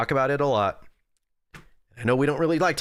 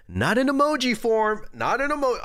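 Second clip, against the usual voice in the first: higher, sharper, insistent; indignant high demand; 10 semitones higher than usual. Pretend. Streamer demanding a real apology from chat, indignant and high. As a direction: scold chat with an indignant squeak.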